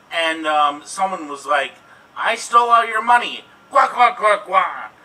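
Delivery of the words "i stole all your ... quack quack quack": loud, higher mock gloating with quacks; quacking crook. Joker voices a thieving bird in a pun, loud quacking crook.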